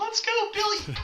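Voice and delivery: high yelling sports parent; sideline parent yell. Retold or imitated. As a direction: cheer like a hyped-up parent from the sideline. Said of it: "the parents on the sideline".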